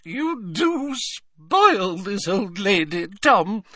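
put-on high, slow fluttery old lady. A narrator's reading. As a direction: coo it like a doting old woman. Old witch voiced in audiobook.

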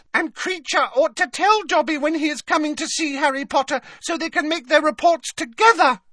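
very high, loud squeaking complaint; resentful squeaky elf. A narrator's reading. Elf resentful of his rival, squeaking high and loud. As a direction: scold a rival in a tiny squeaky voice. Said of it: "he squeaked".

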